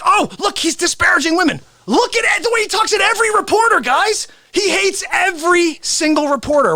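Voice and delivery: high, indignant complaining critics; mock outrage. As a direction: whine your outrage in a high voice. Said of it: "And they're like".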